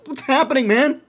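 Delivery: high, loud startled yelp; panicked cry. Retold or imitated. Retelling the dream exploding, startled high cry. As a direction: yelp it out like you just woke in chaos.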